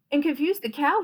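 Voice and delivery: usual speaking voice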